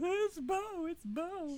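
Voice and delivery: very high excited squeal; squealing excitement. Retold or imitated. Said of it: "she was, like, bouncing".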